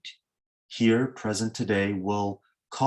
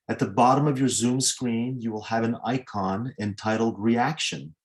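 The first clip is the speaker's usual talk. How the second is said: normal talking voice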